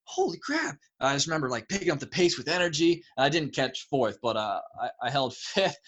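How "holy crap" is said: high-pitched, astonished reaction; re-enacted shock; stunned. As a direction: blurt it in amazed disbelief. Retelling his shock at being fifth.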